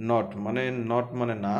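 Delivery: usual speaking voice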